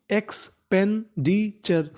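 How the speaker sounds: एक्सपेंडिचर said slowly, eks-pen-di-char; teacher modelling a hard word; slow model word. Shown as a demonstration. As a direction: draw out एक्सपेंडिचर syllable by syllable. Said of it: "इसका मतलब होता है खर्चा".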